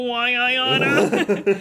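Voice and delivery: loud, drawn-out old-timey mock threat. Mark's taunt answered with a hammed-up threat. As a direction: draw out a hammy threat, breaking into laughter.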